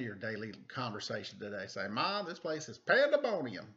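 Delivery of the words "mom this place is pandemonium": ordinary delivery, nothing marked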